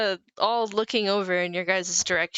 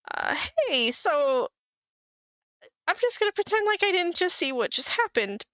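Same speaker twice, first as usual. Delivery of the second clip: much higher, light bard voice; goofy bard; 9 semitones higher than usual. Acted. Goofy bard approaching the adventurers, high friendly voice. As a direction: pitch it way up, breezily sidestepping trouble.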